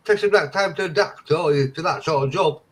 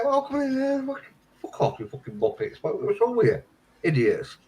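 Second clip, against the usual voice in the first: mock rude staff; 6 semitones higher, 5 dB quieter than usual. Staff scorning the claimants, mock contempt. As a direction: sneer at them.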